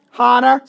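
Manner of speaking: "Hana" with a breathed English H; plain H start. Shown as a demonstration. Co-host showing what some people say, sounded H. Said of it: "some people say".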